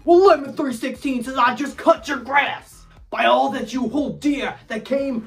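high, fervent preacher delivery; hammed-up sermon; mock preaching. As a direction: preach it like a sports evangelist. Mock sermon quoting scripture.

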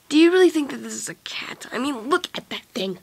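hushed whisper. Acted. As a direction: whisper it nervously. Whispering about the creature.

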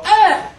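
a sudden high yelp, like a cartoon character being shot; cartoon death scream. Mocking TV cartoons, a shot character screams. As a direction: yelp as if hit, over the top. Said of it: "they go".

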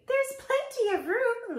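very high, merry bug voice; laughing bug. A narrator's reading. Bug invites the bird aboard, laughing voice. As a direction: squeal a jolly welcome. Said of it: "laughed the bug".

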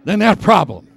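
full-voiced preacher firing up the room; rousing preaching. Real, not acted. Preacher telling the congregation God outweighs their problems, rousing and loud. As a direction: punch the key phrases loud and high, then drop.

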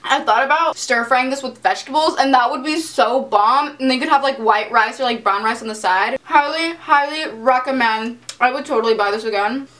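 speaking between bites; talking while chewing. Real, not acted. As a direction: mouth partly full of food. Seen 0: Takes a forkful at 1.0, covers mouth chewing, keeps eating while talking.

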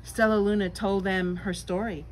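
ordinary delivery

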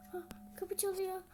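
normal talking voice

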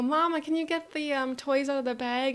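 put-on high little-kid request; eager child to mom. Child asks mom for pool toys, eager. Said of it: "And I go".

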